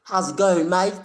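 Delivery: high, loud mock Australian accent; Aussie accent. Streamer greeting his Aussie viewer in a hammed-up Australian accent. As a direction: play up a broad Aussie greeting. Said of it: "The Aussies and their accents".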